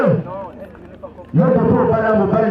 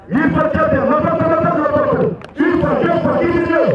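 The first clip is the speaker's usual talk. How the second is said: auctioneer; 7 semitones higher than usual. Acted. Auction chant calling bids.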